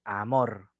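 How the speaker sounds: "amor" said as one word, low; whole word. Shown as a demonstration. Teacher showing the full sound of a word, "amor" in one piece. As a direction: say "amor" complete, just as it is spoken. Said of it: "Como palabra, tal cual".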